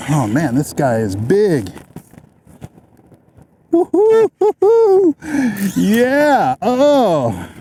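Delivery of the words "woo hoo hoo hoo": long high whoop, loud and stretched; whooping cheer. Real, not acted. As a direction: whoop with joy at the catch. Big fish fighting.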